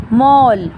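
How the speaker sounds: "मॉल" with a drawn-out rounded aw; English o vowel. Shown as a demonstration. Teacher giving an example of the borrowed sound, long aw in "मॉल". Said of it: "उन दोनों के बीच की sound".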